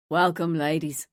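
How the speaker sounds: a hint of a Dublin bouncer's greeting; Dublin accent. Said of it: "he said in a strong Dublin accent".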